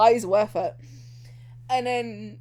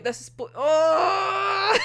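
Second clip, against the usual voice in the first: sudden gasp; 7 semitones higher, much slower than usual. Real, not acted. Overwhelmed by the scene.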